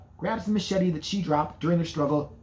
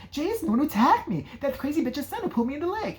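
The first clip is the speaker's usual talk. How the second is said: frantic Alice; 9 semitones higher than usual. Retold or imitated. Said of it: "she's like".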